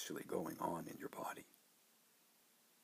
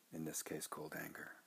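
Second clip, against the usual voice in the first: ordinary delivery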